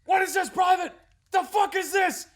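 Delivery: high, loud furious yell at a private; mock drill sergeant shout. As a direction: bark it at the private. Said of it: "drill sergeant gets up".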